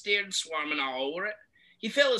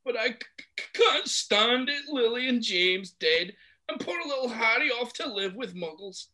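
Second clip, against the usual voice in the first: grief-stricken giant; sobbing; 13 semitones higher than usual. Acted. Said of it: "sobbed Hagrid".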